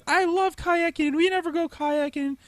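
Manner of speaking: high-pitched mimicry of a girlfriend; put-on high girlfriend voice; Sarah. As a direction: imitate an eager girlfriend, high and loud. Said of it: "that's Sarah's voice".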